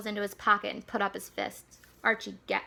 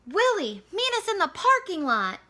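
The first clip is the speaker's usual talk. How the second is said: loud, high yell through a pipe; boy yelling to his dog through a pipe; 9 semitones higher, 7 dB louder than usual. Acted. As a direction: yell to be heard far off. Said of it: "he yelled through the pipe".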